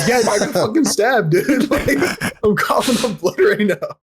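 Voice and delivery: high-pitched mock distress, laughing; pretend stabbed caller. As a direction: wail it to the dispatcher, half laughing.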